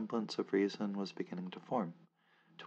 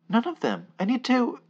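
much higher and louder, near a shriek; shrill refusal; 12 dB louder than usual. Acted. Narrator refusing the men's offer, near shrieking. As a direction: cry out in desperation.

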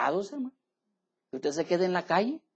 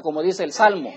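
ordinary delivery

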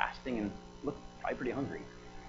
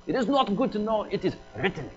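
higher, louder imitated Indian accent; put-on Indian accent, raised in pitch; 8 semitones higher, 6 dB louder than usual. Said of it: "I'll try to throw a little Indian accent".

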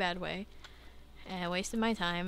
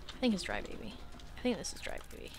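ordinary talk

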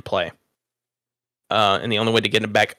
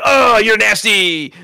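higher, louder, slow teasing yell; mocking taunt; 10 semitones higher, 6 dB louder, much slower than usual. Bully taunting a kid holding the toilet ball, high and loud. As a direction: sneer it like a schoolyard bully.

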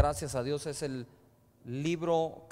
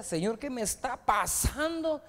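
slower, higher plea to the Lord; pleading prayer; 6 semitones higher than usual. Retold or imitated. Preacher reliving his prayer in the restaurant, slow pleading cry. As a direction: cry out to God, lost and searching.

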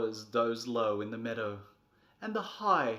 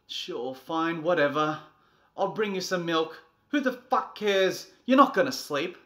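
loud, higher sarcastic yelling; bitter, loud resignation; 5 semitones higher, 12 dB louder than usual. Acted. Defeated parent yelling sarcastically at the child. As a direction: give up, furious.

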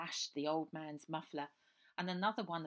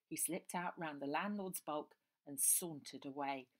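plain reading voice

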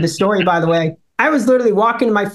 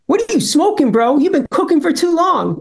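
higher, mock-incredulous rabbi; rabbi's mocking retort; 7 semitones higher than usual. The rabbi teasing him back, high mock disbelief.

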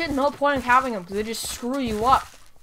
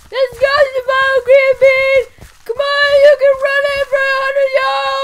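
rally the crowd at full voice; high cheering shout; 11 semitones higher, 11 dB louder than usual. Pretend. Gamer hyping his runner on, high and loud.

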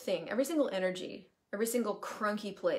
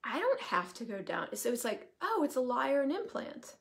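light realization; 6 semitones higher than usual. Retold or imitated. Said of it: "I'm like".

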